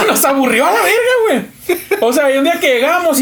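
telling it through laughter; laughing. Real, not acted. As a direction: laugh as you tell it. Laughing while recounting bullying.

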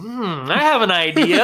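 high, loud scheming character voice; Ashalad. Retold or imitated.

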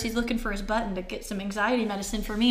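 ordinary talk

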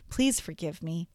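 soft, low, heartfelt plea; meaning it. Shown as a demonstration. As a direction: ask forgiveness gently and earnestly. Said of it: "saying it like you mean it could sound more like".

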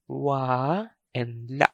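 slow "Wa and la", with "la" cut short by a glottal catch; final glottal stop. Shown as a demonstration. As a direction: separate the syllables slowly and chop the A of "la". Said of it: "short vowel with a stop".

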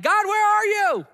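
loud, high, drawn-out wail to heaven; mock anguished cry. Michigan fan calling to God after another loss, mock anguished shout. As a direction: cry out in hammed-up despair, stretching the words.

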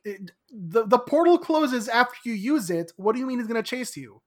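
incredulous, speeding up; exasperated disbelief. Retold or imitated. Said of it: "I'm like".

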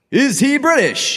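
high game show host announcing. Pretend. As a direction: announce the title like a quiz host.

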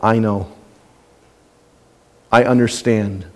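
low, soft and tender; quiet reassuring voice of God; gentle reassurance. Retold or imitated. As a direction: speak as God comforting a struggling child. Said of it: "God breaks through ... and says".

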